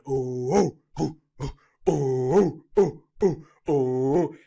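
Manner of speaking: high, rising hooted "oh"s; imitated crowd chant. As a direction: hoot along like an excited audience.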